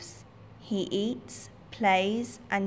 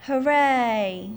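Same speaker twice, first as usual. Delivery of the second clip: high, loud cheer; joyful cheer; 4 semitones higher, 6 dB louder than usual. Acted. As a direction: celebrate the victory. Wolves cheer the tiger's death.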